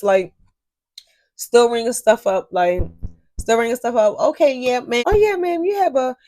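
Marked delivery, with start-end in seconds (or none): 4.2-6.2 higher-pitched retold customer-service voice; acted cashier patter. Cashier ringing up a customer while distracted, acted cashier patter. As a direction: lift the pitch for breezy checkout talk.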